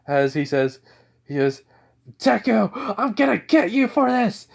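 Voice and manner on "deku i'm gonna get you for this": high-pitched angry threat; acted outrage, pitched way up; vengeful cry. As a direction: cry out a threat to your rival. Bakugo threatens his rival.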